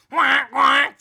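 loud, high imitated quacks; duck quacking. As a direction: quack at the ducks like one of them. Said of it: "like a duck".

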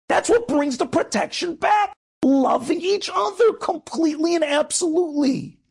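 high-pitched, emphatic exhortation; impassioned preaching. Real, not acted. Rabbi urging listeners to love each other, impassioned preaching.

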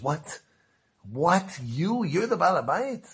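retold disbelief, pitch climbing; incredulous. Visitor questioning the self-declared boss, incredulous. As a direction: act out rising incredulous questions.

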